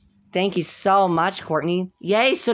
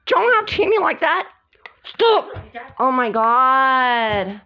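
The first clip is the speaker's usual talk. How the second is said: high-pitched acted protest; distressed pleading; 9 semitones higher, 4 dB louder, slower than usual. Pleading with guys who hit, high distressed protest. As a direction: beg them to stop, voice way up.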